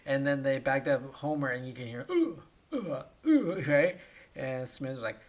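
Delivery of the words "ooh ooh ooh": high, louder hammed-up moans; mock moaning. Homer groans inside the bag, high mock moaning. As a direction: moan like a man stuck in a bag.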